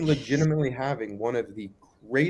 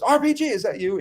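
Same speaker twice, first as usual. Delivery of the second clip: much higher call into the woods; 12 semitones higher, 3 dB louder than usual. Retold or imitated. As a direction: shout his name into the dark. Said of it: "they actually call out into the woods".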